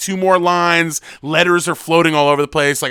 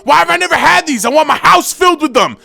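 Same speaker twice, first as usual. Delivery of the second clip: much higher, much louder, half sung; 13 semitones higher, 9 dB louder than usual. Retold or imitated. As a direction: rave about snacks to the whole table. [name] raving over peas.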